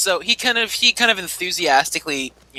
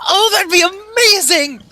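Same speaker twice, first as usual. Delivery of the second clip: much higher, slow, gushing; thrilled; 4 dB louder than usual. Acted. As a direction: gush like an awestruck fan. His eyes just kinda light up.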